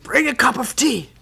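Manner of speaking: loud, curt order for tea; annoyed monk calling for tea. Retold or imitated. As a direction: demand the tea, irritated. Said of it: "More and more annoyed".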